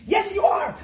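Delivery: loud, high shouted retort; angry king. Acted. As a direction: snap back at the servant. King arguing with servant.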